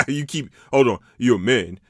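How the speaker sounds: laughing at his own joke. Real, not acted. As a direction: crack up mid-sentence.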